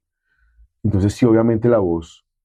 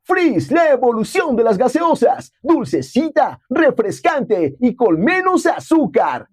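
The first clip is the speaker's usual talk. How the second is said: much higher, slow, bright-sold promo voice; upbeat soda ad read; slower than usual. Shown as a demonstration. Voice actor demos his Freeze commercial, high promotional read. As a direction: sell the soda with the pitch way up. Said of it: "completamente arriba el tono, así".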